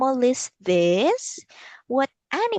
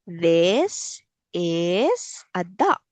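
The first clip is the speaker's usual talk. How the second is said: put-on slow modelling for child; 3 semitones lower, slower than usual. Shown as a demonstration. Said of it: "So again".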